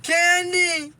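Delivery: high shrill calls for help; screaming a name. Retold or imitated. A girl screaming for Candy as the horse bolts.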